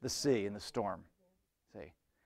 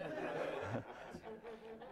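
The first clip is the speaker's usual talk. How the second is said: high laugh; 11 semitones higher, 12 dB quieter than usual. Real, not acted. Laughs at his own joke.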